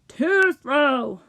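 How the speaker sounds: loud complaint; irritated rejection. A narrator's reading. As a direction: reject it with force. Rich dude rejects a piece.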